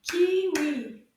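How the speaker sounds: KI-WI, loud and evenly beaten; clapped syllables. Shown as a demonstration. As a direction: punch both syllables of Kiwi for clapping. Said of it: "One more time".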